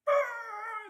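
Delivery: high, loud crowd yell; game master voicing the crowd, a high loud roar. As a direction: erupt like a stadium. Crowd reacts to a thud.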